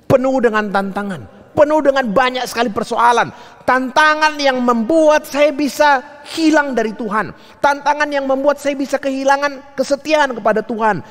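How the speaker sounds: high, insistent preacher's cadence; forceful preaching. Real, not acted. Preacher warning congregation of challenges on the journey, forceful preaching. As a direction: drive each phrase home at a high pitch.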